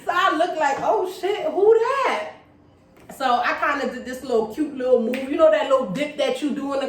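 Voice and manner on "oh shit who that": high, impressed "who's that" reaction; wowed double take. Retold or imitated. Retelling spotting her on the dance floor, high-pitched and wowed. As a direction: play up being struck by someone.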